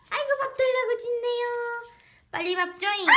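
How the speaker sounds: high, fast whiny puppy voice; voicing the dog. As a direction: whine for food like the dog. (강아지 흉내로 밥 달라고 칭얼대듯)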